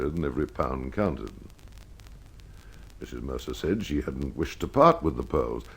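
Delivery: ordinary delivery, nothing marked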